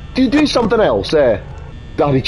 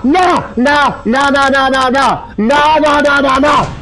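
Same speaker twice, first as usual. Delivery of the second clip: rapid frightened nah, higher; panicked yelling; 4 semitones higher, much slower than usual. Real, not acted. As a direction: yell no in panic. Jump scare panic, repeated nah.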